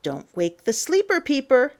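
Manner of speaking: ordinary delivery, nothing marked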